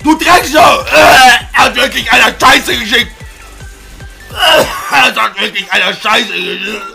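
furious yelling; shouting. Acted. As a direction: scream it in rage. Guy shouting in video.

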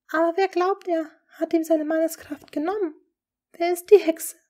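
high, loud and anxious; urgent fearful question. Acted. Lady Rochford to the duke, fearful and urgent. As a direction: press for the name in fear. Rochford asks who the witch is.

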